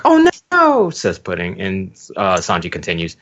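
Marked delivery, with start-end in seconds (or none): 0.1-0.9 high, loud shocked cry; shocked high gasp. Acted. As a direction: gasp it out in alarm. Pudding gasps in shock.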